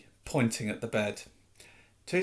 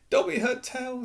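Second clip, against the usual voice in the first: hoarse, higher elf voice; hoarse, conspiratorial elf; 11 semitones higher than usual. A narrator's reading. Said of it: "he said hoarsely".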